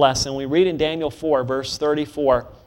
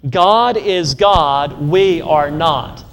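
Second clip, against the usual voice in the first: God is God, drawn out and louder; slow louder creed; 4 dB louder, much slower than usual. Shown as a demonstration. Preacher leading the congregation in a loud, slow response. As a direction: call it out slowly with force on God. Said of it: "You wanna say that with me?".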